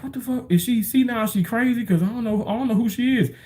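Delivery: quiet, puzzled inner voice; inner bewilderment. Retold or imitated. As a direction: think it in disbelief. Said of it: "I'm in my mind like".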